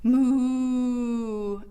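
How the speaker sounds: a lightly voiced cow's moo. Shown as a demonstration. Teacher showing kids the cow sound, mild moo. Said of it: "Let's say hello as a cow again".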